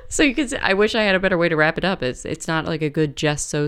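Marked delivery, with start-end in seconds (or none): 0.1-2.0 quick, high words through giggles; laughing while talking. Real, not acted. Host apologises for the story's ending, talking fast through laughter. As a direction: rush it out, still laughing.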